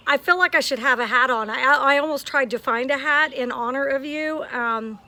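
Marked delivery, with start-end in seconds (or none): none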